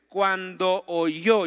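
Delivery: "Cuando oyó" very slow, "oyó" stretched out; underlined phrase. Shown as a demonstration. Pastor reading to the church, "Cuando oyó" slow and stressed. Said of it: "quisiera que subraye esa frase".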